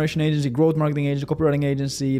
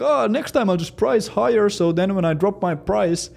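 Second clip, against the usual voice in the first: naive inner voice; 7 semitones higher, 3 dB louder than usual. Retold or imitated. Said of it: "you might think".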